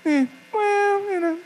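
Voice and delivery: mock squeak. Teller mimics his own stalling answer to the stranger, high squeak. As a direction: squeak out an unsure stalling noise.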